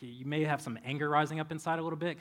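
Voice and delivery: ordinary talk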